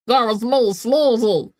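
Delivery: high-pitched put-on cartoon voice; Meatwad. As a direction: do a high, silly creature voice. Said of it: "It's just fucking Meatwad from Advent".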